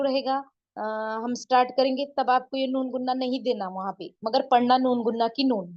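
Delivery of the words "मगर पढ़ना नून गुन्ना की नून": ordinary talk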